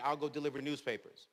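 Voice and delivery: usual speaking voice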